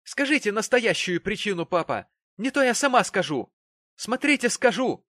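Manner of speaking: high, loud threatening cry; heated, shouted ultimatum; angry shouting. A narrator's reading. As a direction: warn him at the top of your voice. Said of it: "закричала Черри".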